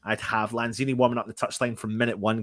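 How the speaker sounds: ordinary delivery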